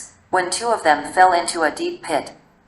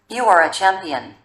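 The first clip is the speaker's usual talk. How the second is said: usual speaking voice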